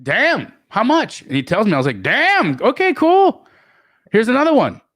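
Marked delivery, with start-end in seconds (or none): 0.0-1.2 impressed surprise. Retold or imitated. Impressed reaction. 2.0-4.8 high, louder delighted reaction; thrilled surprise. Retold or imitated. He's amazed Josiah sold it fast, high and thrilled.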